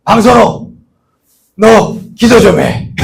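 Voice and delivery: loud, slow commanding call; boss's order. Retold or imitated. Boss telling the employee to pray, loud and slow. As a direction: bark the order, drawn out.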